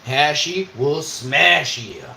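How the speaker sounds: mock tourism slogan, punched up. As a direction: lean harder into the slogan. Slogan said again.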